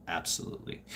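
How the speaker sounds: breathy whispered "Absolutely.". Acted. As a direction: drop "Absolutely." to a toneless whisper. Repeats answer, then whispers it.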